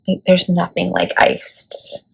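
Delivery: hushed adoring whisper. Real, not acted. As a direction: whisper it like a secret about the shade. Gushing over favorite shade.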